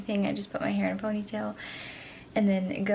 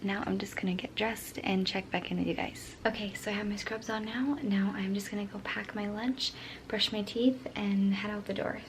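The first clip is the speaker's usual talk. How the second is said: soft early-morning whisper; 8 dB quieter than usual. Real, not acted. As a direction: keep it hushed, house asleep. Still whispering, family asleep.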